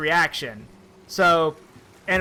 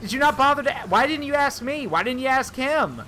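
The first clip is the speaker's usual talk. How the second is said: mom scolding; 6 semitones higher than usual. Retold or imitated. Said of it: "She's like".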